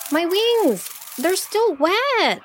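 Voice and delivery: high, squeezed pleading wail; crying out. A narrator's reading. Dragonfly grabbed by monkeys, crying out in protest. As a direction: wail it high while struggling free. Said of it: "she cried".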